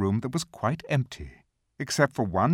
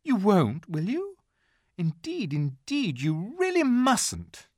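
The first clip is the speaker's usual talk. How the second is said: put-on high, slow, earnest girl's plea; a child begging her friend not to; pleading little girl; 11 semitones higher, slower than usual. A narrator's reading. Lucy begging Mister Tumnus not to betray her, pleading.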